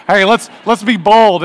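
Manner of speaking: rally yourself slowly and firmly; bold resolve. Retold or imitated. Preacher mocking himself urging boldness after embarrassment.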